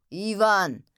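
loud summoning call of a name; commanding shout across to someone. A narrator's reading. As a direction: call sharply for a subordinate. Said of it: "rief der Dunkle".

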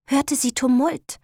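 usual speaking voice

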